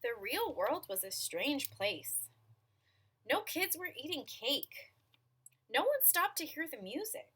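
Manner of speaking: sing-song storytelling with swooping pitch; picture-book narration. A narrator's reading. Reader telling children about the strange real world, storytelling. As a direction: read each sentence as its own little picture.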